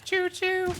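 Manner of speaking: high loud choo choo; train whistle choo choo. Pretend. Spotting tracks in the game, a high train toot.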